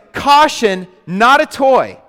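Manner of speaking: high, loud hammed-up label reading; mock warning label. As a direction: read the warning like it's absurd, rising in outrage. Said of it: "it goes".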